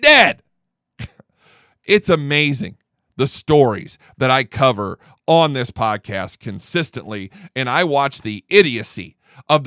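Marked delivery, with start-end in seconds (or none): none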